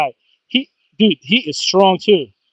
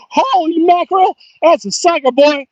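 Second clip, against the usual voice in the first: excited whoops; 9 semitones higher than usual. Real, not acted. Angler thrilled at catching a big fish, whooping. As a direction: shout with joy as the fish comes up.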